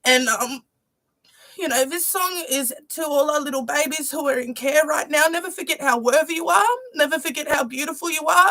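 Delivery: ordinary delivery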